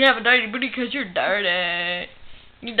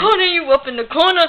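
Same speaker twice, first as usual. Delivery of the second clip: higher, louder sing-song taunt; 5 semitones higher, 7 dB louder than usual. Acted. Godzilla puppet taunting Spider-Man a second time, louder and higher. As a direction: push the taunt up and out.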